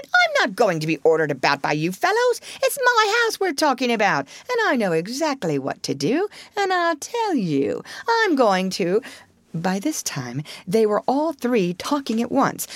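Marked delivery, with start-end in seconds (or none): 0.0-9.0 quick, indignant, pitch leaping high; getting excited. A narrator's reading. Toad insists it is his house, excitedly. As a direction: rattle out the protest, worked up. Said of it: "getting excited".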